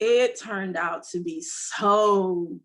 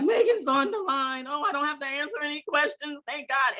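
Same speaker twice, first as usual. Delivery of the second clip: retold relief, higher and quicker; mock relief, rattled off fast and higher; relieved excitement; 7 semitones higher than usual. Relief that Megan will answer.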